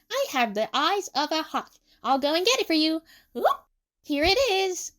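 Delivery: high, perky boast; Emu. A narrator's reading. Emu fetches the phone for Tsukasa, perky.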